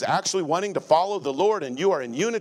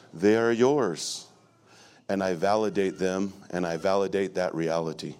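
a lightly voiced pretend therapist, lower and unhurried; mock therapist; 6 semitones lower than usual. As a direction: play a patronizing counsellor in jest. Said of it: "I'm going to be your therapist for a moment".

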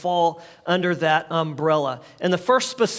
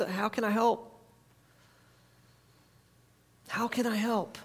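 much softer, caring ask; gentle offer; 10 dB quieter than usual. Retold or imitated. Speaking to an anxious friend, soft and caring. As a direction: ask it tenderly.

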